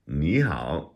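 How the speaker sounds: NI HAO with NI rising, HAO dipping; changed third tone. Shown as a demonstration. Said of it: "the first one changes to a second tone".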